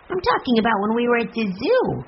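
put-on high child voice; insistent little boy. A narrator's reading. Benny reminds his sister of the zoo, high child voice. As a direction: explain it in a small boy's high voice.

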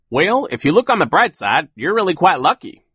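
high, loud, cheery voice; optimistic Phil. A narrator's reading. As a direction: find the bright side, chirpy. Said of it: "optimistic friend".

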